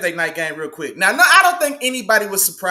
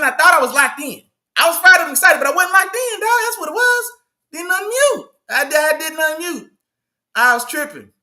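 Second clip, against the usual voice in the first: ordinary talk, nothing marked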